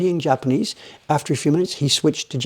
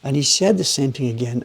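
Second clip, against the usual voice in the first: normal talking voice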